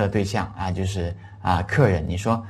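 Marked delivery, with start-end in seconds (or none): none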